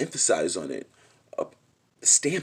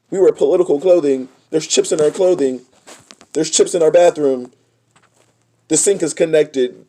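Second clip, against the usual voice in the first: high, loud, fast and breathless; grandchildren's voice; 8 semitones higher, 12 dB louder than usual. Acted. Voicing the grandchildren describing their connected future, high and loud. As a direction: rattle off the future like an eager kid. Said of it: "what are the grandchildren saying?".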